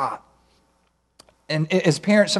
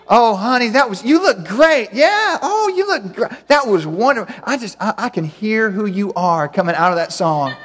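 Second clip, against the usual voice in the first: [name] gushing; 4 semitones higher than usual. Retold or imitated. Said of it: "You're like the [name] of parenting".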